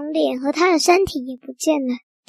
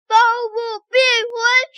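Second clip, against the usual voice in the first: casting a spell; 7 semitones higher than usual. Acted. Magician pointing the wand at himself, high stretched incantation. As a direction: call out the magic words grandly. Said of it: "指着自己说".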